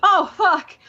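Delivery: high, loud imitated swearing; mock a friend's startled exclamation; retold shocked reaction. Friend reacts to the screencapped conversation, retold shock. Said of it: "And he's like".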